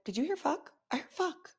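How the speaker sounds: high, hushed disbelief to a client; shocked aside. Retold or imitated.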